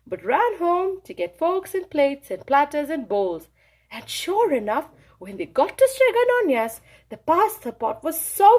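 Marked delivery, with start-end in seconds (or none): none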